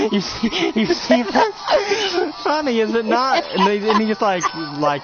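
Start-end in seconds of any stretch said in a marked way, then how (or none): 0.1-3.4 very high, slow, laughing gloat; shrieking laughter into taunt; mad laughing villain. Acted. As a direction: cackle and taunt, unhinged.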